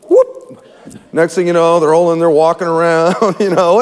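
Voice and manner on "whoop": short, high-pitched whoop; quick imitated whoosh upward. Preacher mimics sudden moment in the fire, high whoop.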